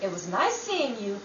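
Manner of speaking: usual speaking voice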